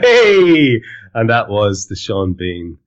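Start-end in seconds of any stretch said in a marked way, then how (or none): 0.0-0.8 excited hey. Real, not acted. Cheerful shout after the clip.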